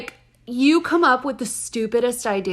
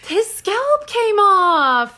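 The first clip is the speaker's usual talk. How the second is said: much higher, slow, stunned cry; horrified disbelief; 11 semitones higher, 5 dB louder, much slower than usual. Real, not acted. Reacting to Jill's grisly news story, horrified. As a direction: gasp out the horror, dragging each word.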